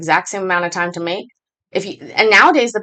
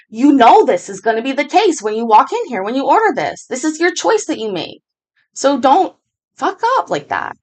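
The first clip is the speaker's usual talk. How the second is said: firm, rising higher as it goes; retold scolding; 6 semitones higher than usual. To a customer yelling at staff, firm scolding climbing higher. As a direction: lecture her, pitch climbing. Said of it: "I'm just like".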